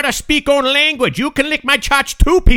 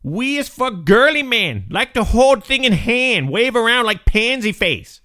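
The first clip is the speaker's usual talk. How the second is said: rude foreign guest in broken English; broken-English foreign character. Acted. Comedy guest mocking the host, broken-English accent. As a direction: insult the host in a thick put-on accent.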